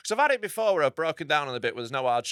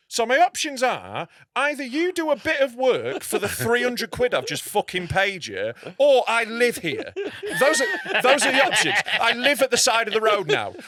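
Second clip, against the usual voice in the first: higher, louder sarcastic ultimatum; sarcastic rant; 6 semitones higher, 3 dB louder than usual. Retold or imitated. Driver to mechanic, sarcastic rant. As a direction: lay out absurd options with mock calm. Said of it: "what I wanted to say was".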